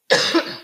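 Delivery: short real cough; a quick dry cough; coughing fit. Real, not acted. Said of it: "coughing so much today".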